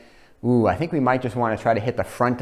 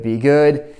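louder, urgent plea; 3 semitones higher, 5 dB louder than usual. Real, not acted. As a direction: push the plea harder.

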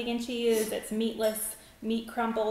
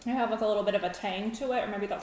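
talking between bites; chewing while talking; much faster than usual. Real, not acted. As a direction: mouth partly full of food. Seen 1: Daughter holds slice, chewing between phrases, mouth partly full.

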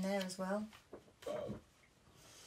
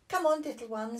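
higher coaxing granny; lightly voiced kind granny; gentle granny; 8 semitones higher, 8 dB louder than usual. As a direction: coax the little one into bed. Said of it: "said Granny Pig".

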